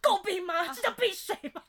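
loud, high, indignant complaint about the ice; imitating a grumpy father's outburst, laughing; mock angry dad. Daughter mimics her dad yelling about the ice water. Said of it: "那他就说".

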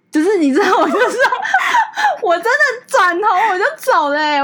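high, loud laughter through the words; laughing. Real, not acted. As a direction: laugh while recalling storming off. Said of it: "laughing retelling". (边笑边大声说)

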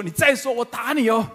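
husband losing his temper in a fight; loud threat. Retold or imitated. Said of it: "举起手来大声的说".

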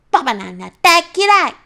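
put-on angry child. Sulky child yelling at dad she hates him, loud. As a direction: snap back at dad in a huff. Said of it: "プンプンと怒った".

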